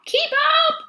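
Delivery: high, loud shout; call out. Pretend. Reader calling to the animals to keep up, high shout. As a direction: call ahead to the lagging animals.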